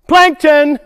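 loud, high punchline answer; called out answer. Shown as a demonstration. Presenter calling out the answer to the children, loud and high. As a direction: belt it out high for the kids. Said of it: "Everybody say".

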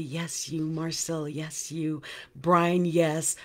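ordinary talk, nothing marked

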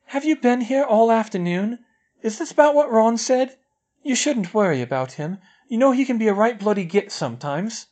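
put-on a girl calling to her crying friend; coaxing schoolgirl. A narrator's reading. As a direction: coax a friend out gently. Parvati coaxing through door.

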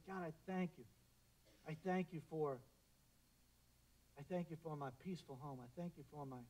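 soft, hushed prayer of thanks; quiet thankful prayer. Pretend.

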